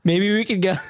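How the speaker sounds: normal talking voice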